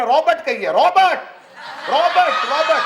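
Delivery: calling out. Retold or imitated. Merchant calls name.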